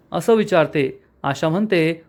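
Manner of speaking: ordinary delivery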